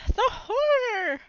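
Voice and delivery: high, hammed-up horrified shriek; mock scream. Librarian shrieking at the chewed-up book.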